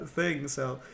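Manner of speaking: normal talking voice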